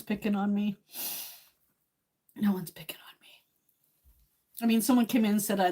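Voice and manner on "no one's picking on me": hushed repeat under the breath; whispered reassurance. Real, not acted. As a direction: whisper it to yourself.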